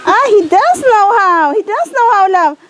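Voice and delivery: high, bright excited praise; delighted. Real, not acted. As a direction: squeal with delight at the dog. Delighted dog fetches.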